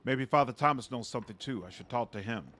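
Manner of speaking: normal talking voice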